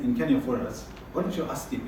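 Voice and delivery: usual speaking voice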